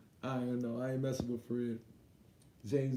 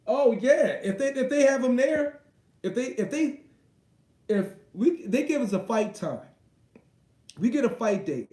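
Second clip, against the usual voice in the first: high, loud and eager; excited; 7 semitones higher, 7 dB louder than usual. Real, not acted. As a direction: pump it up like you can't wait. Excited about fighting in London.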